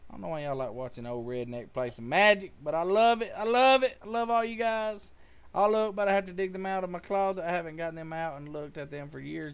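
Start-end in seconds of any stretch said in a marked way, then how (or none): 2.6-5.1 loud, high, delighted; excited thanks to viewers. Real, not acted. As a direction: gush to the viewers.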